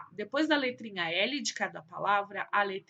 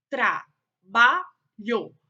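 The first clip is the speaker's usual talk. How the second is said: "trabalho" very slow and loud, tra-ba-lho; syllable by syllable; 7 dB louder, much slower than usual. Shown as a demonstration. Teacher showing pupils syllable division, "trabalho" in separated syllables. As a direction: split "trabalho" into three syllables with clear pauses. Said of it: "foram separadas em sílabas".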